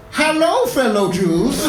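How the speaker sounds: high, hammed-up fake friendly greeting; mock undercover Nazi. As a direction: overplay a sneaky fake welcome. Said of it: "Just like".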